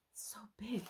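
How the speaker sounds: soft excited whisper. Real, not acted. Whispering to viewers about the big table. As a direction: breathe it out in a hush. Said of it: "Look at".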